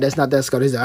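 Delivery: ordinary talk, nothing marked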